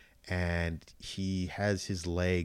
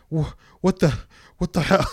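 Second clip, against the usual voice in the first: higher, louder mock bewilderment; half-acted confused outburst, higher and louder; baffled exclamation; 10 semitones higher, 9 dB louder than usual. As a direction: play the dazed man coming to. Mimics Oscar Isaac's confused character.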